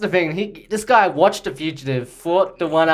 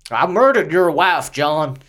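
put-on line read in a light Southern American accent. Actor reads the audition line for the director, light Southern accent. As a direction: say it like a mild Southern villain. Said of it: "It's very southern".

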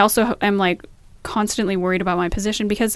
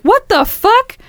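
high, loud shocked outburst; 13 semitones higher, 7 dB louder, much slower than usual. Retold or imitated. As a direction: blurt it out in alarm. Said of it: "I was like".

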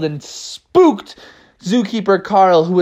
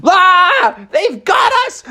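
high panicked shout; Zookeeper Carl; 14 semitones higher, slower than usual. Acted. Unmasked zookeeper realises he is caught, high alarmed cry. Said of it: "Zookeeper Carl was like".

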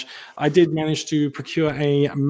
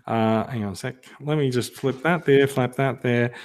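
ordinary talk, nothing marked

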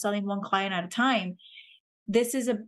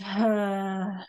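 audible exhale sigh. Shown as a demonstration. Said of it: "make a sound".

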